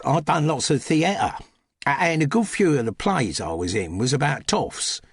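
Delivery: cheerful cockney servant explaining; cockney housemaid. Acted. Harvey telling the Duchess about the halls, chirpy cockney.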